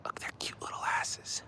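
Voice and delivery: soft whisper. Real, not acted. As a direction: keep whispering tenderly. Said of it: "[name], why stop?".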